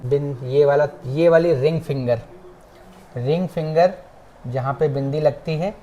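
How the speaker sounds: usual speaking voice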